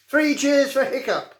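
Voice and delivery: put-on rally the boys into a cheer; excited cheer. A narrator's reading. Boy rallies his friends to cheer Hiccup, excited call.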